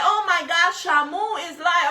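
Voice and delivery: loud, high, slow mock announcement; mock hater. Imitating a commenter mocking her weight, loud and high.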